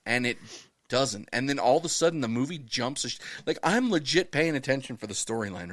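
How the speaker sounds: normal talking voice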